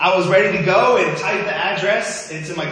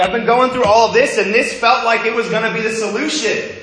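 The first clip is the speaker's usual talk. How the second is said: higher and louder, pouring it out; higher, louder exasperated rush; exasperated outburst; 9 semitones higher, 8 dB louder than usual. Retold or imitated. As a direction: vent how much you hoped this would fix things. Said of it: "I'm like".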